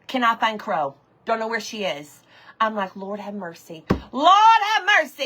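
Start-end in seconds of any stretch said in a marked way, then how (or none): 3.0-3.8 low, quiet, worried aside; muted dismay. Retold or imitated. Retold low reaction to missing hen. 4.1-5.2 loud, high, delighted call-out; excited exclamation. Real, not acted. Breaks off to hail viewers.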